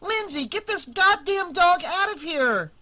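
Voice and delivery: high, loud desperate yell. Acted. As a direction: scream for help at the kid. Yelling at child about dog.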